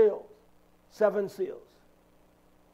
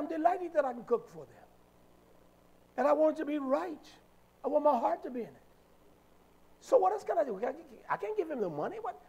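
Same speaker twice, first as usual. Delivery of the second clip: higher and quicker, pitch climbing; pleading a bit more with every line; excited retort; 6 semitones higher, 6 dB quieter, much faster than usual. Retold or imitated. Retold reply, rising excitement.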